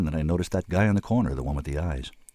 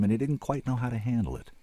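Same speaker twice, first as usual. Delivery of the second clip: usual speaking voice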